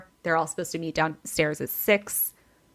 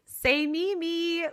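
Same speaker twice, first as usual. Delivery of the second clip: much higher, sing-song mock of the aunt; mocking Aunt Kathy; 10 semitones higher, much slower than usual. Teller mocking aunt at group photo, high sing-song imitation. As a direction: mimic her silly photo cue.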